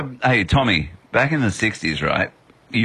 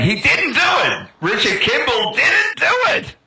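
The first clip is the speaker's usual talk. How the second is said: frantic shout; 12 semitones higher than usual. Retold or imitated. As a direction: yell it like a desperate fan.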